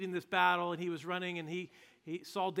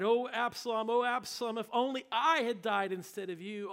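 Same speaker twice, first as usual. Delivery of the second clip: high, loud anguished wail for a lost son; crying out in grief; 5 semitones higher, 6 dB louder than usual. Retold or imitated. King David crying out for his dead son Absalom, wailing. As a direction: cry the name out, heartbroken. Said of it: "he cried".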